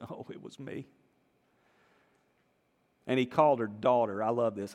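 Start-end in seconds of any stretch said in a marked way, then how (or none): 0.1-0.9 much softer, a timid admission; fearful confession. Acted. Healed woman falling before Jesus, softly confessing. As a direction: own up in a small scared voice. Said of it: "fearing and trembling".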